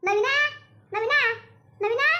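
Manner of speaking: calling out. Acted. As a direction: call the son from the next room. Mother calls son from afar.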